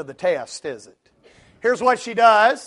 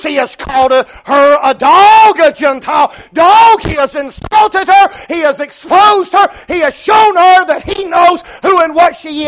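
full-force preaching; 8 semitones higher, 13 dB louder than usual. Acted. Preacher listing how Jesus insulted her, full-force preaching. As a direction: build louder and higher with each charge.

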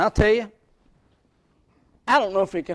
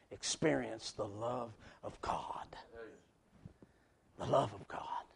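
slow, soft whisper of devotion; 5 semitones lower, 13 dB quieter, slower than usual. Acted. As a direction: breathe it to the congregation. Tender whispered preaching.

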